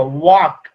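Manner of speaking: "वॉक" said alone with a silent L; correct pronunciation. Shown as a demonstration. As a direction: model "वॉक" clearly, no L before the K. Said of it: "कैसे बोलना है इसको". ("वॉक" में ल को चुप रखकर)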